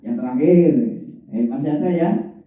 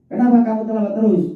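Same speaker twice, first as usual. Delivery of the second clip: sharp, fast, high-pitched scolding; high-toned punisher; 12 semitones higher, faster than usual. Shown as a demonstration. Teacher to an always-late pupil, scolding in high tone. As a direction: demand an explanation, high and quick. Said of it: "Nadanya tinggi biasanya itu".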